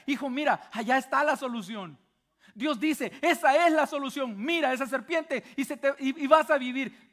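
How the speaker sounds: más agudo y fuerte, urgente y esperanzado; padre que anima. Acted. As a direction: empuja al hijo a mirar, con urgencia. Padre anima al hijo a mirar. (el padre le insiste al hijo que mire y viva)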